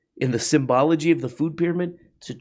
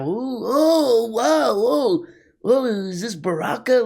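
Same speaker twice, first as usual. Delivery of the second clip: mock awe; 10 semitones higher, slower than usual. Mocking awed documentary viewer.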